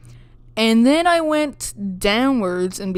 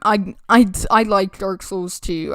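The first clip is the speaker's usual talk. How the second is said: usual speaking voice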